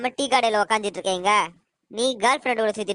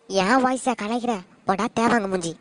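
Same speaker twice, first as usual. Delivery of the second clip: ordinary delivery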